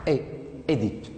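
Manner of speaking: Édipe with a clear "é" first; traditional pronunciation. Shown as a demonstration. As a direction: sound out the "é", pause, then Édipe as "é-dipe". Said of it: "la première prononciation, on prononce".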